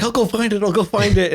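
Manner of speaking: high-pitched eager offer; eager helper. Acted. Renarin offering to find the necklace, acted eagerness. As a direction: pipe up high, keen to help.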